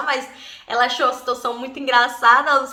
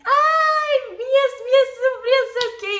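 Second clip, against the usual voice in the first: high, loud delighted greeting from an aunt; excited aunt; 12 semitones higher, 4 dB louder, slower than usual. Retold or imitated. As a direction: squeal hello to the little nieces. Aunt arriving, greeting nieces.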